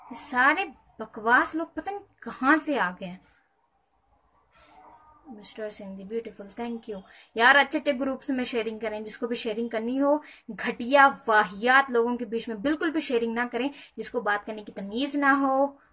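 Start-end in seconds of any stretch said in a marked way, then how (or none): 0.1-3.2 loud, high-pitched complaint; fed-up, raised-voice grumble; exasperated. Real, not acted. Fed up with rude commenters. 7.4-15.8 loud, insistent telling-off; raised voice, emphatic and irritated; annoyed scolding. Real, not acted. Scolding viewers about sharing to groups.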